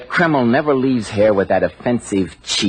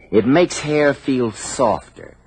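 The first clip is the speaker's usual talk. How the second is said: ordinary talk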